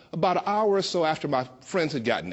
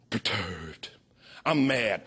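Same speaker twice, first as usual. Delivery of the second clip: deep comic drawl; 8 semitones lower, much slower than usual. Acted. Comic deadpan after "Now I am.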